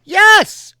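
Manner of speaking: high loud eager yes; rider's yes. Acted. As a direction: shout yes. Martin answers crew.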